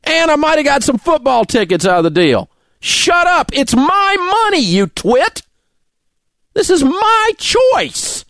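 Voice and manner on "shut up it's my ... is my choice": raised much higher, hard and insistent; slow down on my choice, still fuming; angry outburst. Real, not acted. Angry rant at critics.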